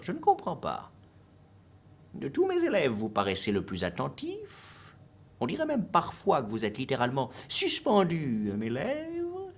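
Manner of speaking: high, loud exasperated teacher voice; Monsieur Valu, vexed. A narrator's reading. Teacher to his most attentive-looking pupil, vexed high-pitched outburst. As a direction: scold at full voice, pitch leaping way up. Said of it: "la voix vexée de Monsieur Valu".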